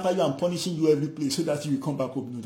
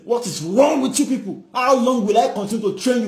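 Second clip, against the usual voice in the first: higher, louder scolding of the people; angry rebuke; 6 semitones higher, 6 dB louder than usual. Acted. Jesus angrily rebuking his disciples. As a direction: rebuke them with exasperation. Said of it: "Jesus was angry".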